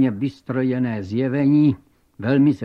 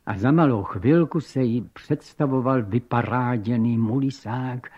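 ordinary delivery

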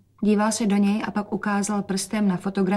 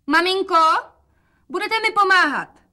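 much higher, louder, cheerful call; bright calling out; 11 semitones higher, 5 dB louder than usual. A narrator's reading. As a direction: call out loud for father to hear. Dcera hraje veselost pro otce. (hlasité veselé volání)

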